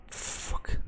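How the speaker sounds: breathy whispered swear; hushed fuck; whispered curse. Real, not acted. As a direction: curse under your breath so the guard won't hear. Guard spotted, sneaking nearby.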